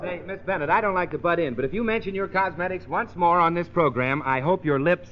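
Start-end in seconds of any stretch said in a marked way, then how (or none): none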